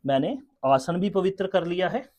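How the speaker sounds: ordinary talk, nothing marked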